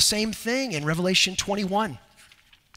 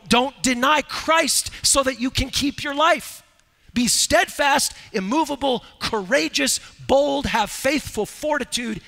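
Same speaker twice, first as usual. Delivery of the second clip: preacher rallying the church against fear; preaching at full force; 6 semitones higher than usual. Acted. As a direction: urge the church to stand firm. Exhorts the church directly.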